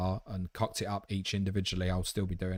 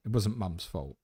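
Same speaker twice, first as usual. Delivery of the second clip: ordinary delivery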